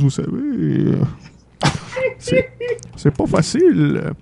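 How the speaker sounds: high, loud plea easing into grumbling; complaining Santa Claus. Acted. Santa grumbles about no mail.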